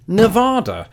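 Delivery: "Nevada" with a broad "ah" in the middle; vowel as in tostada. Shown as a demonstration. Showing his old assumption to the state, "Nevada" said Ne-VAH-da. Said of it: "second vowel was equal to that in tostada".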